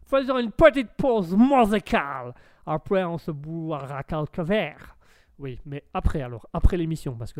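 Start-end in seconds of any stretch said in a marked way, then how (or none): 0.1-2.3 put-on high, loud American-accented French. American agreeing to the music break, loud American accent. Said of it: "bel accent de bouffeur d'hamburger". 2.7-5.0 put-on slow American-accented French. American promising drinks after the break, American accent. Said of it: "bel accent de bouffeur d'hamburger".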